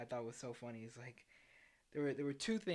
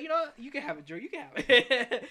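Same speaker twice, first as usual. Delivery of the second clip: laughing; 9 semitones higher, 12 dB louder than usual. Retold or imitated. David offering the bed.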